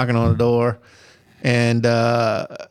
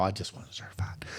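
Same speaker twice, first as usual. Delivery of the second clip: whispered aside; 6 semitones lower, 16 dB quieter than usual. Real, not acted. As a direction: drop to a whisper for the confession. Aside to the listeners.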